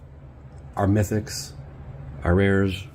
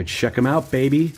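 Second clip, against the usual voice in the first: normal talking voice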